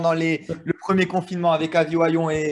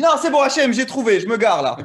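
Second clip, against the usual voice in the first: put-on high, louder Sentier-accented brush-off; 6 semitones higher, 6 dB louder than usual. Driver dismissing Hachem once parked, Sentier accent. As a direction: toss off the punchline in a Sentier accent, pitched up and loud. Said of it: "C'est vrai que t'as eu l'accent".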